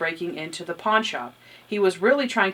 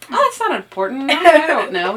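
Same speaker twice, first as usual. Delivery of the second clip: higher, flustered imitation of Natalie; Natalie dodging; 6 semitones higher, 7 dB louder than usual. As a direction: brush it off with nervous brightness. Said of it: "She's like".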